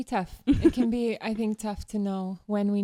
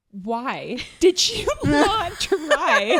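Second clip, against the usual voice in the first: slow, higher, louder hammed-up indignation; mock angry; 5 semitones higher, 6 dB louder, much slower than usual. Scolding colleagues about untried buns, mock angry and slow. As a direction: stretch it out like a little tornado. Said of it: "all angry at the world".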